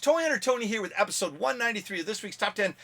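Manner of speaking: high, loud hyped show intro; big channel-opener announcer voice. Acted. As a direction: pump up the show title like a host.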